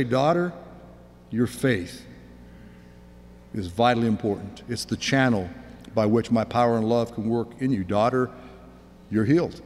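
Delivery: low, gentle, with pauses; tender reassurance. Acted. Jesus to the woman he healed, tender.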